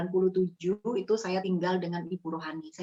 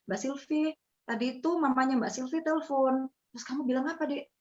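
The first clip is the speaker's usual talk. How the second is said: higher, lightly voiced young housemate; housemate's question; 6 semitones higher than usual. Boarder tells Sylvie her mother phoned, lightly voiced. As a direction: ask a little anxiously. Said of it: "anak yang di kos di rumah itu bilang".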